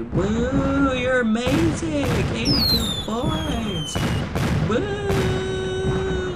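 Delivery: high, drawn-out tired cheer; stretched-out high-pitched woo. Pretend. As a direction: whoop for the winner in a high voice. Mock cheering for the winner.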